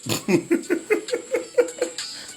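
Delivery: high-pitched chuckle in a long run; laughing. Real, not acted. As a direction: giggle away at your own teasing. Laughing after teasing Sophia.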